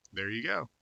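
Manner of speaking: usual speaking voice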